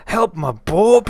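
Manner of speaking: high, very loud cry; loud storybook fright; alarmed toad. Acted. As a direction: burst out in shock at the monster. Toad sees the Gruffalo.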